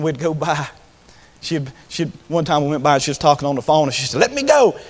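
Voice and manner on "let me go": high, loud imitated exclamation; retold excited outburst, pitched up; excited hurry. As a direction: burst out eagerly, as on the phone. Woman hurrying off the phone, retold.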